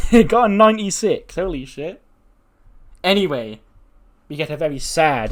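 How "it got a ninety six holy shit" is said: much higher and louder, laughing through it; thrilled. Real, not acted. Announcing a huge segment rating to viewers, thrilled. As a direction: blurt the score out delighted.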